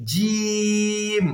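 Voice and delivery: "জিম" held long on one level note, tongue middle to palate; drawn-out jim. Shown as a demonstration. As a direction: stretch the vowel of "জিম", then close on the m. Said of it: "মিম বললে আবার ঠোঁটের শুকনো অংশ". ("জিম" জিহ্বার মাঝখান তালুতে লাগিয়ে লম্বা টেনে)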